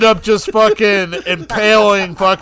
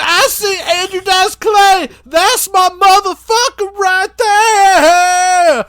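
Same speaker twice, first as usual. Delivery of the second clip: much higher, slow, mocking psycho voice; Sick Ripper voice; 9 semitones higher, slower than usual. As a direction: squeal it like a giddy maniac.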